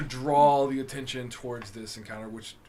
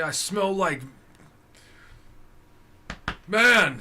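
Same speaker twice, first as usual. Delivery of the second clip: proud orc; 7 semitones higher, 10 dB louder, much slower than usual. Acted. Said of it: "is what he'll say".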